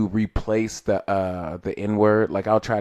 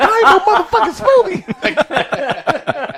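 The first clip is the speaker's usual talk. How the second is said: high, loud, drawn-out comic outburst; mock indignant shout; 5 dB louder, slower than usual. Retelling comedian's protest to the crowd, mock indignant shout. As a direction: shout it in laughing mock outrage.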